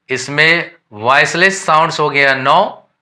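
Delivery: ordinary delivery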